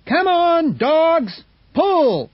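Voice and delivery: high, loud command to the team; young man shouting at his sled dogs, high and loud. Acted. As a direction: yell at the dogs to pull. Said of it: "he shouted".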